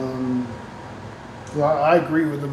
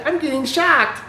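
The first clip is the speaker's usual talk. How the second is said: high, crying mock wail of a granny being shocked; 11 semitones higher than usual. Joking about Granny in a straightjacket, mock shocked cry. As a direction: squeal it like a jolted granny in a straightjacket, half laughing.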